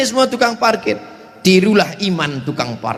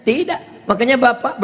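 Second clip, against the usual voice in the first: normal talking voice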